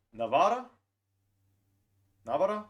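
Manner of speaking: "Navarra" tried out loud with a questioning rise; testing "Navarra" different ways; trying pronunciations. Shown as a demonstration. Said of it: "I don't know how to pronounce this one".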